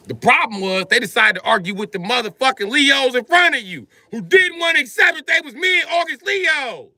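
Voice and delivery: high, loud ranting shout; comic rant. Acted. Comedian ranting at mid-August Leos, loud high shout. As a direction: yell it like a wronged man in line.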